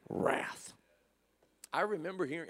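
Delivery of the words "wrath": breathed word wrath; soft whisper ending the line. Shown as a demonstration. Soft answer turns away wrath.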